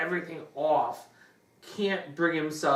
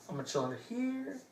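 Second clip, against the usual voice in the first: softer, fast mock hush; hushed hiding; 7 semitones higher, 10 dB quieter, faster than usual. Retelling the diver hiding from the shark, quick and hushed.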